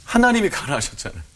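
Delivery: re-enacted protest to God, pitched high; high-pitched reproachful appeal, retold; resentful prayer. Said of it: "원망의 기도를 하는 거예요".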